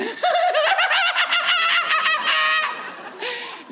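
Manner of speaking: high, shrill-free cackle of a waitress laughing at him; mocking laugh. Waitress laughing at his wrong gender, high mocking laugh.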